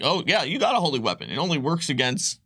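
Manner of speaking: ordinary talk, nothing marked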